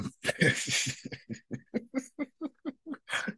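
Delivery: quiet, high giggle; soft run of laughter at a private joke; amused laugh. Real, not acted. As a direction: laugh softly, knowing what is coming. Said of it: "so I'm laughing".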